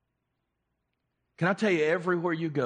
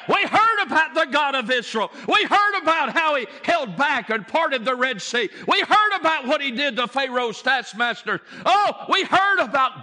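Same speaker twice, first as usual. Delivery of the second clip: high, loud excited telling; Rahab excited; 10 semitones higher, 6 dB louder than usual. Acted. Rahab telling the spies, loud and excited. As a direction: burst with the news of Israel's God. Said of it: "what did she say?".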